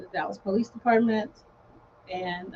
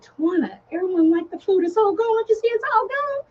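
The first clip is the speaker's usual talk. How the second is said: higher, rising excitement; excited reassurance; 9 semitones higher, 4 dB louder than usual. Retold or imitated. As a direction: bubble over with good news. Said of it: "And Margie was like".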